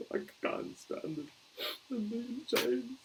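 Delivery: high, slow wailing sobs; sobbing. Acted. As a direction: blubber it through tears, voice cracking up. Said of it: "sobbed Hagrid".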